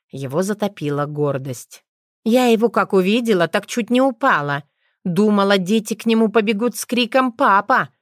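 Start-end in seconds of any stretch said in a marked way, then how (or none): 2.2-7.9 a louder, higher gossiping voice; a witch telling her friend eagerly; excited witch. A narrator's reading. (взволнованная ведьма)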